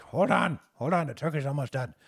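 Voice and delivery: put-on high, loud harried old cook; busy old chef shouting from the back; old cook calling out. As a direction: call out from the kitchen, flustered. GM voices old cook Herb.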